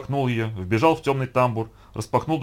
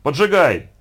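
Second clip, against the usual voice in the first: loud director's order; one loud, punchy call; shouted command; 3 semitones higher, 7 dB louder, slower than usual. A narrator's reading. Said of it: "закричал Яков Борисович".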